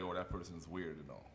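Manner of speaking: ordinary talk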